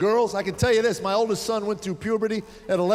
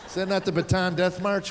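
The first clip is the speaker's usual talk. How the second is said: usual speaking voice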